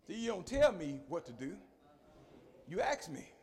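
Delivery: usual speaking voice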